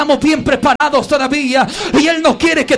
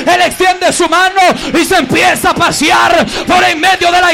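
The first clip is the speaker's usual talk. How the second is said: full-force preaching; 3 semitones higher, 4 dB louder than usual. Real, not acted. Pastor building to a triumphant climax, full-force preaching.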